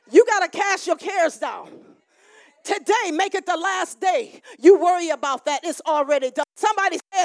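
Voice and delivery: ordinary talk, nothing marked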